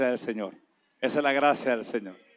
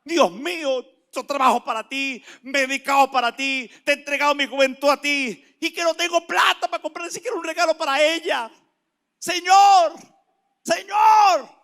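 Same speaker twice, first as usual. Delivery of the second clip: loud, high impassioned plea to God; batalla con Dios; 11 semitones higher, 11 dB louder than usual. Retold or imitated. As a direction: cry out in protest, building to shouts of the name. (súplica a gritos, cada vez más intensa)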